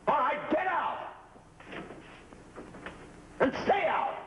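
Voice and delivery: high, slow yell; furious shout. Acted. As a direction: bellow him out the door. Shouting agent out of room.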